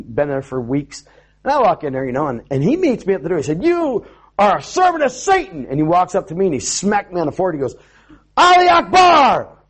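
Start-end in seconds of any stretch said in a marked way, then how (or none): 3.6-5.7 high-pitched angry accusation; mock outrage, pitched well up; mad accuser. Rocky meets the pastor at the door, mock-angry accusation. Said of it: "he was all mad". 8.4-9.6 slow, high, full-voiced shout; imitated yelling. Man smacks the pastor on the forehead, imitated yell. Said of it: "he's yelling at me like that".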